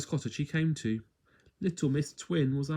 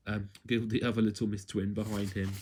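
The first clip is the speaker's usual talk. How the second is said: plain reading voice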